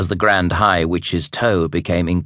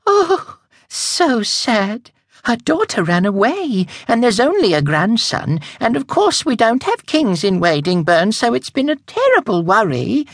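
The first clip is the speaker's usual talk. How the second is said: romantic lament. Acted. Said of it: "heaved a romantic sigh".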